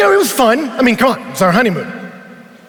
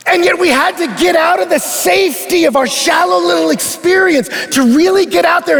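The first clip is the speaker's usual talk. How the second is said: preaching build; 8 semitones higher than usual. Real, not acted. Sermon climax, preaching full force.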